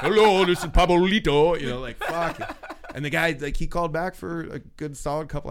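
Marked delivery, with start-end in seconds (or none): 0.0-1.6 high, loud put-on phone greeting; Pablito. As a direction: answer in a silly raised disguise voice. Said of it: "it's with a different voice".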